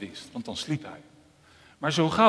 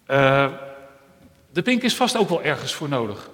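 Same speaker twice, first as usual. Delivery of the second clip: ear defending; 7 dB louder than usual. Acted. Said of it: "die opkwam voor de pink".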